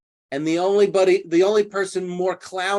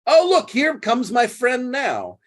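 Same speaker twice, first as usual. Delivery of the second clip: cheerful announcing; 8 semitones higher, 4 dB louder than usual. Retold or imitated. Toby announces Andrew's arrival.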